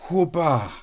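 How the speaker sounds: high, bouncy sound effect "Whoobah"; mock fanfare sound as he grabs the plates; whoosh of triumph. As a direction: toss it off like a magic flourish. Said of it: "like that".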